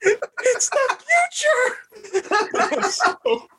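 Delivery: mock old man. Old man in time-travel ad.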